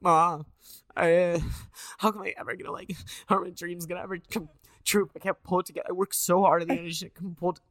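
high, loud mock sobbing; tearful. Grown man calling mom after a failed audition, mock crying. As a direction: wail it like a hammed-up crybaby. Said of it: "I cried again, and I called my mom".

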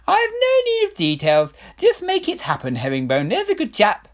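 high, loud royal brush-off; dismissive king. Acted. King dismissing Herringbone, high and breezy. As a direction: wave it away breezily.

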